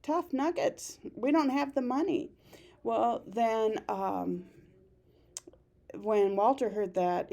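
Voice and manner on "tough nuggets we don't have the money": higher, curt brush-off; a teacher's lightly voiced Ruth, dismissive; mock dismissive Ruth. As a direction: toss it off like she's shutting it down. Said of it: "And she's like".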